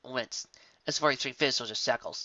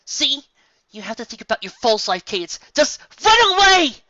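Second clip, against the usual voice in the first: high, loud frantic shout; frantic plea; 12 semitones higher, 14 dB louder than usual. A narrator's reading. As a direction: Twilight begs Cadance to run. Twilight pleads shouting.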